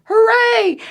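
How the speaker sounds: loud, high whoop of joy; bear cheer. Acted. Happy bear finding the picnic, loud cheer. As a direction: cheer it out big.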